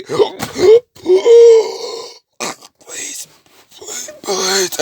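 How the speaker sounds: gasping, voiceless pleading; strangled whispery plea; choking. Acted. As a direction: beg through a blocked throat with no voice. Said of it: "I know he's choking".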